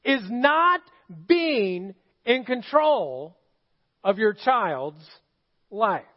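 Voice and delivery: each chunk slowly with pauses between; slow, spaced out. Shown as a demonstration. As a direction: drag it out so every word lands. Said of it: "the hardest thing that there is".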